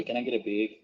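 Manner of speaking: "big" in place of bag; regional "bag". Retold or imitated. Asking in the store, regional "big" for bag. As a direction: ask for a bag, pronouncing it "big". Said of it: "I'll say".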